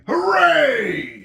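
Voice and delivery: loud, high cheer; triumphant shout. Acted. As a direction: crow with delight. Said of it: "shouted Yertle".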